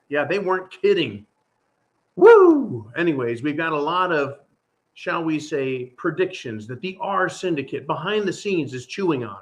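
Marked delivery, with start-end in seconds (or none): 2.2-2.9 loud, high sarcastic whoop; excited whoop. Real, not acted. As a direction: let out a big loud woo. Cheer after Biden quote.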